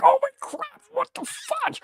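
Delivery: high squeaky mock panic; panicked squeal, acted out; freaking out. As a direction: retell it as a falsetto freak-out. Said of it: "I'm freaking out".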